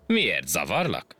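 usual speaking voice

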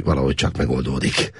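ordinary delivery, nothing marked

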